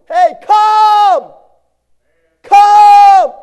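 huge high shout of come; calling out. Retold or imitated. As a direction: bellow the invitation across the room. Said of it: "Christ looks at you and says".

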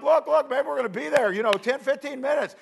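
high, eager insisting; can't wait, voice up high with excitement; excited. Retold or imitated. Groom to his wife about the mountains, excited and high. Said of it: "but I am".

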